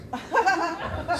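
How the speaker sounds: a burst of high laughter at the story. Real, not acted. Preacher laughing at his own story.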